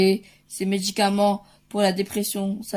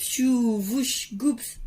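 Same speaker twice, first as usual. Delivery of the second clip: playful zoom, swoosh and gulp; mimed flying noises; 2 semitones higher, much slower than usual. Pretend. Parent flying Superman soup to a child, playful swooshes. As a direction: act out a superhero's flight in noises.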